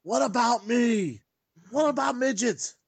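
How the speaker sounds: high, loud, drawn-out comic protest; mock indignant character voice. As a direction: wail it out in a put-on squeaky plaint.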